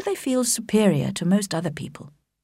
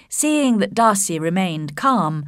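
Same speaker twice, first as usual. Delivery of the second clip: usual speaking voice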